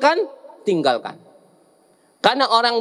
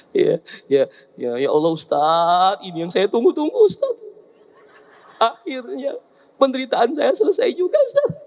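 hammed-up joy breaking into laughter; mock relieved husband. Husband teasing the preacher about his wife dying, laughing.

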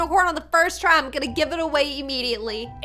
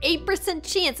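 normal talking voice

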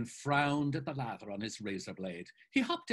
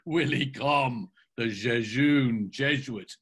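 loud, slow, teasing delight; a touch of laughter, then draw out the mockery; gleeful mock announcement, louder and drawn out; delighted laughing cry; 7 dB louder, slower than usual. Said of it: "laughing with delight, cried".

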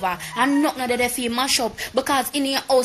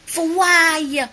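loud, higher-pitched demand; indignant demand; 5 semitones higher, 6 dB louder than usual. Real, not acted. Vlogger demanding an explanation from rival, loud and indignant. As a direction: throw the question out loudly. Angry demand repeated louder.

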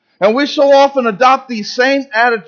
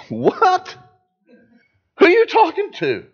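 high, incredulous, as if looking around; stunned disbelief; 11 semitones higher than usual. Acted. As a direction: react like someone who can't believe he's addressed. Gideon's stunned reply to God.

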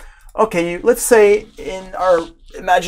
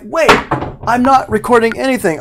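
higher, louder alarmed realization; sudden panic; 9 semitones higher, 5 dB louder than usual. Pretend. Engineer spotting the unrecorded take, alarmed. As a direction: gasp it out as the take slips away.

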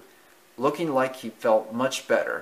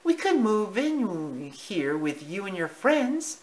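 mother mouse voice; 9 semitones higher, slower than usual. Acted. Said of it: "she suggested".

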